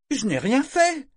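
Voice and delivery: high, loud indignant protest; cri indigné. A narrator's reading. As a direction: protest your innocence hotly. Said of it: "indignée". (protestation aiguë et indignée de jeune fille)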